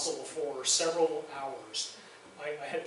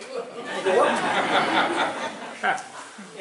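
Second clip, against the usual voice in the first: a loud real burst of laughter; 4 semitones higher, 11 dB louder than usual. Real, not acted. Laughing at the curator's offer.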